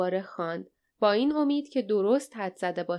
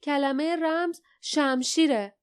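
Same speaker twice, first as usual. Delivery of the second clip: higher-pitched announcement; mild narrated shout, more pitch than volume; calling out; 6 semitones higher than usual. A narrator's reading. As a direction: call out the answer with raised pitch. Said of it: "فریاد زد".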